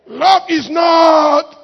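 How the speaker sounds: huge drawn-out shout falling away at the end; shouted verse. Real, not acted. Preacher hammering the verse at the congregation, shouted. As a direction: roar LOVE IS NOT high and slow, then drop on "puffed up". Verse roared as punchline to rebuke.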